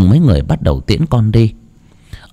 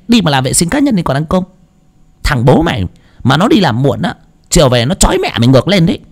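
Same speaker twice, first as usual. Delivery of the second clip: scolding mother; 7 semitones higher, faster than usual. A narrator's reading. Mother scolding her son.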